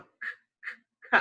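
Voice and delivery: k said as a bare voiceless click of breath; k sound. Shown as a demonstration. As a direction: model the sound k without a vowel. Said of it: "beginning sound for k".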